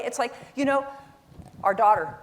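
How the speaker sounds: usual speaking voice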